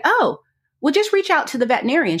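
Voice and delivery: breezy quick helpful suggestion, pitched high and fast; chirpy helpful commenter. Retold or imitated. Commenter tipping her off, high and quick. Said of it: "And she was like".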